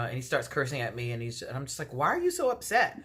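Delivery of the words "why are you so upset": high-pitched, innocent-sounding protest; retold protest. To a date who starts cursing, high retold protest. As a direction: question it in a raised, imploring pitch.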